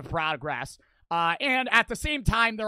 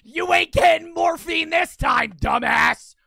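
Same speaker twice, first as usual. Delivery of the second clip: high, loud outraged yell; angry shouting; 7 semitones higher, 9 dB louder than usual. Real, not acted. Streamer refusing morphine to game character, angry shouting. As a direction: yell it, punching each word.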